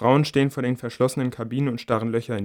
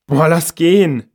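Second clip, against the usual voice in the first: slow, louder, higher dismissive groan; slow loud put-off teen voice; fed-up girl; 6 semitones higher, 10 dB louder, slower than usual. A narrator's reading. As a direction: give up and tell her friend to leave. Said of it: "meint das eine Mädchen".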